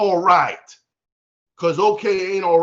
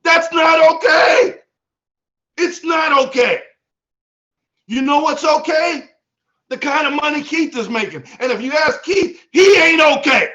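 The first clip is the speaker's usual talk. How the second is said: fired-up shout, pitched high; excited; 6 semitones higher, 4 dB louder than usual. Real, not acted. Speaker firing up the team against settling, excited shouting. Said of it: "you could tell [name]'s a little excited".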